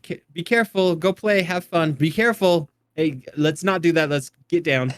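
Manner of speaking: fretful mom calling be careful at play; worried parent. Retold or imitated. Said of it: "I'm like".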